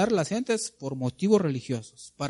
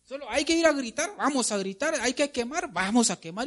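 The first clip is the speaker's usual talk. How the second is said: higher-pitched mock mob chatter; excited back-and-forth of mock followers; crowd egging each other on; 9 semitones higher, 3 dB quieter than usual. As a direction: lightly imitate a mob talking itself into it. Voicing the clueless crowd egging on.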